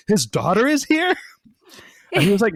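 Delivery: high, loud retold disbelief; incredulous, rising sharply at the end; astonished question. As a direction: react to startling news, voice leaping up. Retold reaction to surprising news.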